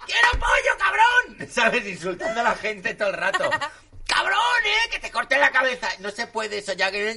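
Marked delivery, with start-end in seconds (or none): 0.1-1.5 very high, loud swearing tantrum; furious royal shriek. Pretend. Reina insulta gritando. 4.1-5.9 mock royal rage. Reina insulta gritando.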